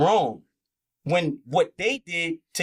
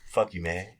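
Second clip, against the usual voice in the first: much lower, softer sneer; 12 semitones lower, 5 dB quieter than usual. Retold or imitated. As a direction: growl it low and quiet.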